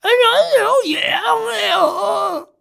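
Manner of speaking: very high, strained, slow croak; a wrecked voice after swallowing charcoal; ruined voice. Shown as a demonstration. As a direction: sound like charcoal burned your throat. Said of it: "이런 식으로".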